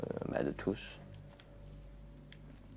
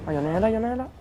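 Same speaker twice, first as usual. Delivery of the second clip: startled alarm; 12 semitones higher, 5 dB louder, much faster than usual. Real, not acted. Spots a zombie, startled.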